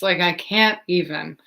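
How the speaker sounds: usual speaking voice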